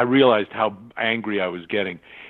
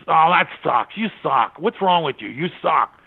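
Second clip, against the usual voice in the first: mock insulting boss; 5 semitones higher than usual. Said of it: "and he says".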